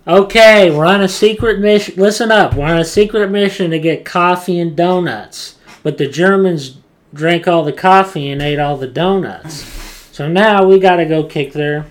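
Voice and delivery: commander impression. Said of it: "he's like".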